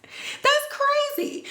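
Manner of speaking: high, disbelieving question; high-pitched disbelief; incredulous. Retold or imitated. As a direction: ask it like you can't believe it. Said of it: "I'm just like".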